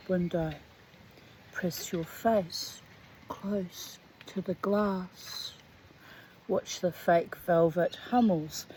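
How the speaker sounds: slow, deliberate reading aloud; story narration. Acted. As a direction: read the story slowly and evenly. Reads the short story aloud.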